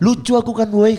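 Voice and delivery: high, loud, smug boast; cocky heckler. Retold or imitated. Heckler on stage boasting to the comedian, smugly. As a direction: brag like a show-off who thinks he's funny.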